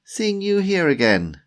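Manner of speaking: loud, high, overdone greeting; feigned delight. Acted. Timothy greets Tanya he hoped to avoid, feigned delight. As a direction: act pleasantly surprised, high and loud.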